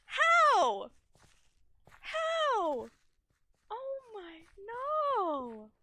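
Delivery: high, loud wails of disbelief that slowly settle; high, loud, real wailing over a lost run; stunned dismay. Real, not acted. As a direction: plead with the game in real dismay. Fell through the map, dismayed.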